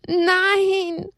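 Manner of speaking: high, crying Nein; sobbing no. Acted. Little donkey sobbing as Jesus dies, high and crying. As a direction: wail it through tears. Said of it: "schluchzte Haberle immer wieder".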